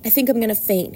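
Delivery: ordinary delivery, nothing marked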